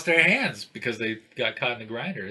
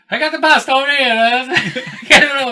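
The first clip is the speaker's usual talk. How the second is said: high, loud excited call through laughter; excited mock shouting; 11 semitones higher, 6 dB louder than usual. Acting out bomb squad handling pasta.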